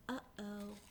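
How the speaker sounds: breathy, near-silent uh-oh; the smallest duckling whispering; whispered uh-oh. Acted. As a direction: hush it out. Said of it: "The last duckling just whispers".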